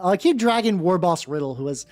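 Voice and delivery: ordinary delivery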